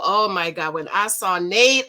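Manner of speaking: ordinary talk, nothing marked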